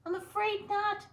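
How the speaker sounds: very high squeaky scientist voice; professor. Acted. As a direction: address the mice like a prim professor. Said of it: "said Professor O'Hare".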